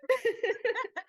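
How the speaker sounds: a big burst of laughter; loud high laugh. Real, not acted. Cackling at her own joke about the trigger.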